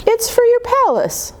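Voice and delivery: high, bright gift offer; sweet little girl. Acted. Girl giving her handmade doll to Molly, high and sweet. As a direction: pitch up high like a shy child.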